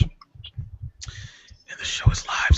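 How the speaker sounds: hushed whisper, no voice. Real, not acted. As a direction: whisper it so the stream can't hear. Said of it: "so now I have to whisper".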